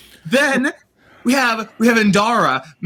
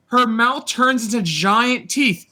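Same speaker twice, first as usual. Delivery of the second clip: ordinary talk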